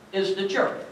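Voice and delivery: sudden soft drop on "is the church"; quiet reveal after the build; hushed. Real, not acted. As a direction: pull back to almost nothing.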